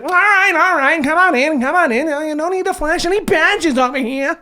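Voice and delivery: high, nasal-free squeaky little clerk voice; nervous little clerk waving them through; dweeby museum guy. Acted. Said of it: "the little dweeby guy's like".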